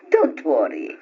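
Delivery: ordinary talk, nothing marked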